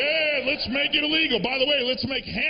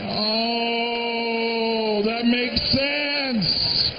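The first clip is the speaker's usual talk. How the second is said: mock revelation; much slower than usual. Real, not acted. Comic reacts to the animator, hammed-up realization. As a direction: stretch it out like a big reveal.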